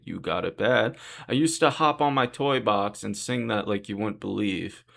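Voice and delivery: ordinary delivery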